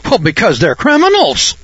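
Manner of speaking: a hint of shocked indignation; righteous indignation. Student repeating answer with mock indignation. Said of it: "in holy righteous indignation".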